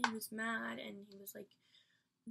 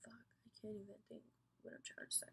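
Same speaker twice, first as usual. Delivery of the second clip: barely-voiced, much softer whisper to herself; frustrated whisper; 12 dB quieter, faster than usual. Real, not acted. Upset vlogger losing her thought, whispered under breath.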